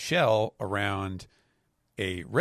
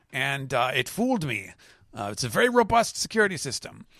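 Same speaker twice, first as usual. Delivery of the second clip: higher put-on elder's voice; villain explaining; 7 semitones higher, 3 dB louder than usual. Elder apologizing to his colleague, higher put-on voice. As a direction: lay out the excuse in a raised character voice. Mars explaining the decoy snail.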